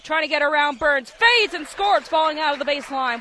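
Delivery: play-by-play surge as the shot falls; excited scoring call. Real, not acted. Calling a drive and score live.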